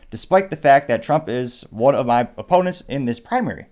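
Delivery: reading a statement aloud. Real, not acted. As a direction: read it out firmly, like a formal statement. Reads a candidate's written statement aloud.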